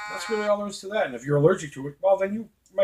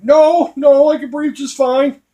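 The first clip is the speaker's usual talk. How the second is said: much higher and louder, slow, frantic; frantic denial; 11 semitones higher, 12 dB louder, slower than usual. Retold or imitated. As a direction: insist you're fine while clearly panicking. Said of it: "I'm like".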